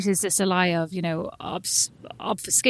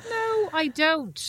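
defensive; 7 semitones higher, 3 dB quieter, much slower than usual. Real, not acted. Denying the pursed mouth.

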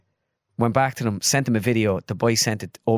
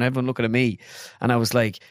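ordinary talk

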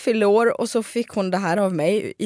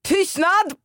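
high, drawn-out microphone call; retold shout; 9 semitones higher than usual. Viking host silencing the whole restaurant, imitated shout. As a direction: call the room to silence, mildly mocked. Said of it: "skriker över hela lokalen".

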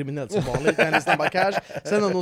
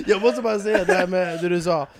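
normal talking voice

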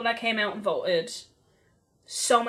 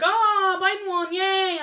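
mock cheer; 7 semitones higher, slower than usual. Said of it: "And I was like".

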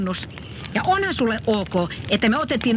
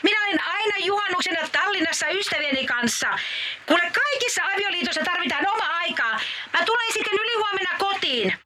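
high, bubbly wife chattering from Tallinn; a giddy, high-pitched holiday wife; Joulumuori voice; 8 semitones higher than usual. Acted. Wife calling Pukki from Tallinn, high and cheery.

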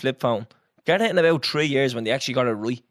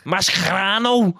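mock Scouse accent; 5 semitones higher, slower than usual. Shown as a demonstration. Showing a friend the accent's hard sounds, mock Scouse. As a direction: belt it out in caricature Scouse. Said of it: "Put a hard K".

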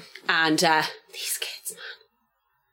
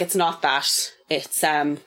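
usual speaking voice

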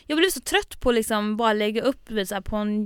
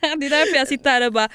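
usual speaking voice

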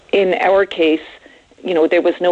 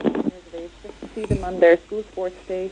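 phone line breaking up. Real, not acted. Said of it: "You're breaking up on me there".

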